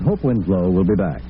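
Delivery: ordinary talk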